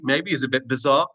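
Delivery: ordinary talk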